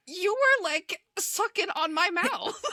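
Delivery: high-pitched, slow mock protest; mock indignation. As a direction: protest incredulously through laughter. Acts out an indignant retort, laughing.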